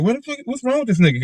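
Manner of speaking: high, alarmed what the fuck; mock alarm at a missing friend; worried disbelief. Imagining no call from his friend, high alarmed disbelief. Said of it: "I'd be like".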